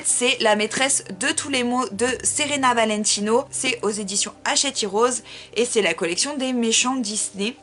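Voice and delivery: ordinary talk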